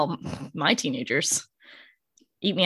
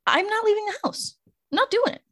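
defiant, much higher insistence; 13 semitones higher than usual. Retold or imitated. Host vowing to stay home in a storm, high and defiant. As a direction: dig in your heels, pitch jumps up.

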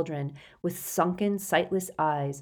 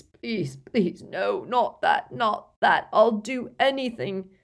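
begging in torment, rising and louder; tormented old wizard begging; anguished pleading; 4 semitones higher, 5 dB louder, slower than usual. A narrator's reading. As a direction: plead desperately, building to a cry. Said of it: "screaming again".

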